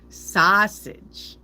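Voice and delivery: sausage drawn out, "saaah-sij", rising; long vowel. Shown as a demonstration. Reader testing how it would sound, long-drawn sausage. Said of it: "it would sound long".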